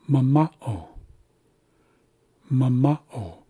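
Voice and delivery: "mama'o" stressed on the middle "ma"; second-syllable stress. Shown as a demonstration. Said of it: "we're placing emphasis".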